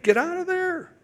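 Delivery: put-on high, sharp warning cry to a child; urgent parent's shout. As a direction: yell it like a parent seeing danger.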